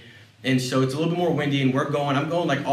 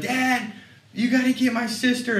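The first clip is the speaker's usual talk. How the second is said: put-on much higher, pleading child voice; scared little girl; 10 semitones higher than usual. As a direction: mimic a frightened six-year-old begging her dad. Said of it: "Parker's, you know, running around".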